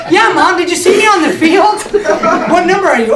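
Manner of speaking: fake-brag to mom through laughter; mock proud son. Son's imagined chat with mom.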